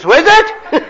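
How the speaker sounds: high, loud parrot squawk. Acted. Parrot answering the plumber's rant, high loud squawk. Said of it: "Parrot says".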